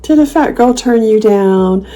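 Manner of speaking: slow, syrupy fake pity; mock sympathy. Answering a troll with slow fake pity. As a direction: coo at him like a sulking child. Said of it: "And I just say".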